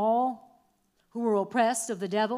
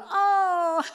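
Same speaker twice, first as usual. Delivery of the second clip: mock revelation; 8 semitones higher, 3 dB louder than usual. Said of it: "You go".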